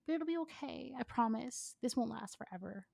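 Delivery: comforting Ino. Acted. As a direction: soothe her through tears.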